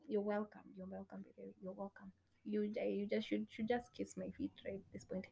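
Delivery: very soft, self-satisfied undertone; smug aside. Acted. Accepting imagined thanks for that information, smugly quiet. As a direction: drop right down, quietly smug.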